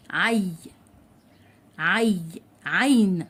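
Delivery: "عين" with a fully audible y glide; clear ya sound. Shown as a demonstration. Teacher sounding out the word for children, carefully enunciated. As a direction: separate "عي", then complete "عين". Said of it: "لازم تنطق الياء بشكل واضح".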